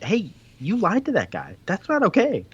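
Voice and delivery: high-pitched, indignant telling-off; mock scolding. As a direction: act out an outraged sibling objecting. Retold sisters scolding Scrooge.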